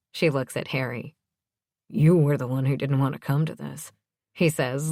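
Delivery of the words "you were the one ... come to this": ordinary delivery, nothing marked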